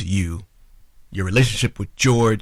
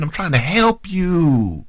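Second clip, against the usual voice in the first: mock pleading tone; 8 semitones higher, slower than usual. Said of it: "put that tone on me".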